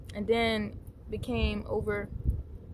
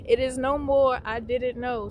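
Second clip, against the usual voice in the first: loud, emphatic proclamation; punchy and raised; emphatic declaration; 3 semitones higher, 6 dB louder than usual. Real, not acted. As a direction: declare it with force, fresh from a laugh. Exclaims after laughing, then repeats seriously.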